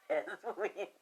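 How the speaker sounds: quiet, giggly retelling; amused realization. Retold or imitated. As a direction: say it through a chuckle. Laughs while repeating retold reply.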